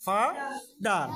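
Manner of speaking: Father said very slowly, each syllable stretched; teacher stretching the word after spelling it; slow drawn out. Shown as a demonstration. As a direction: pull father apart slowly for the class. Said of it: "এফ এ ফা".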